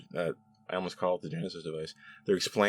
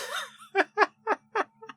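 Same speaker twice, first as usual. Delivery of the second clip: long high laugh. Real, not acted. Reacting to over-the-top character names, laughing. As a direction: burst out in a high-pitched laugh.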